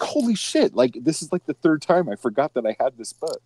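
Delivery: a hint of high-pitched disbelief, mostly own voice; lightly acted surprise at finding the book; mock shock. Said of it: "I was like".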